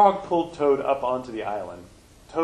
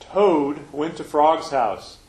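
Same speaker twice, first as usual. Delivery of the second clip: put-on reading to children; 4 dB louder than usual. Minister reading a picture book to children, storybook voice. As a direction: read it out to the children gathered up front.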